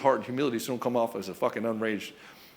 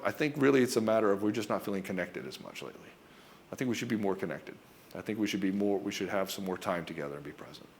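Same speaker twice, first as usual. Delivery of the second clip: low, soft confession that fades quieter; heartfelt; 5 semitones lower, 6 dB quieter than usual. Acted. Husband asking wife for more time together, heartfelt. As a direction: speak it gently from the heart, voice dropping away. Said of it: "this is something coming from the heart".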